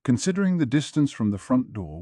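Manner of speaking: usual speaking voice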